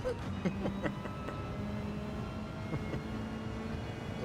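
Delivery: long, high, airy wheeze of laughter; real laugh; breathless laugh. Real, not acted. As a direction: collapse into a voiceless wheezing laugh. After acting out the funeral scene.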